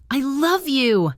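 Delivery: high, loud, raw cry; desperate shout. Acted. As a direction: shout it out in despair. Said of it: "His shout echoed ... raw and desperate".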